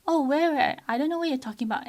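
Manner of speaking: high, loud, quick feigned innocence; mock innocent child. Parent imitating child feigning not to see crumbs, high and fast. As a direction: play dumb in a hurry, voice up. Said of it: "And then they said".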